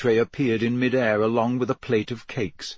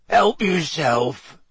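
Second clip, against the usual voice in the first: slow, gruff half-giant voice; big rough West Country giant; lightly voiced gruff Hagrid; 5 semitones higher, much slower than usual.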